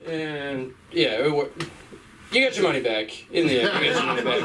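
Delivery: ordinary delivery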